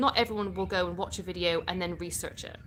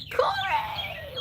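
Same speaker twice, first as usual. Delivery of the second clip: very high squeal of a name; delighted call-out. Real, not acted. Calls out to viewer sending gift.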